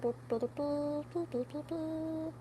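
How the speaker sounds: soft, high tootling; quiet mimicked trumpet fanfare; horn fanfare imitation. Shown as a demonstration. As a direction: toot idly like a little horn. Horn fanfare while waiting.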